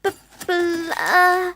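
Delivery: high, slow, stuck stammer; girl who cannot read stumbling aloud; struggling stammer. Acted. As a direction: get stuck on every b. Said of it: "Slowly, Susie began to read".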